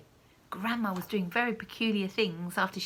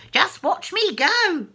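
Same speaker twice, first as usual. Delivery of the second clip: high, loud, slow boast; crowing crone; calling down; 8 semitones higher, 9 dB louder, slower than usual. Acted. Grandma boasting down to George. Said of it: "she called down to George".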